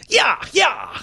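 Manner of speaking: high, loud "yeah yeah" holler; cowboy yell. Acted. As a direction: holler it like whipping a horse. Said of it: "Like I'm whipping a horse".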